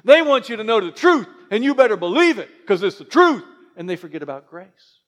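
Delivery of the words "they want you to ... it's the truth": louder, pushy imitation of a harsh preacher; mock hard-line preaching. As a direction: lay down the truth like a scolding church. Mocking truth-only churches.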